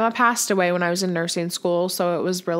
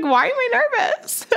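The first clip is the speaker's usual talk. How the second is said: much higher, squeaky bewildered question; bewildered squeak. Retold or imitated. Bride asking herself about her nerves, high squeak. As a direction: squeal it like you're baffled at yourself. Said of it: "I'm like".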